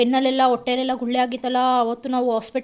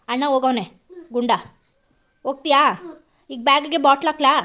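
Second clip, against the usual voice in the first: ordinary talk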